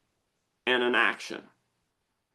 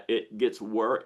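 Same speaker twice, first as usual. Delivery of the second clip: usual speaking voice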